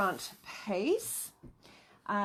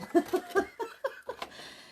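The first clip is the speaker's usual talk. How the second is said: high giggle; 12 semitones higher than usual. Real, not acted. Laughs at the machine.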